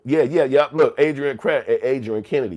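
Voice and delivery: loud, high shout of recognition; excited shout-out. Real, not acted. Host hyping a chatter who knows it, loud and excited. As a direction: call the name out with a grin.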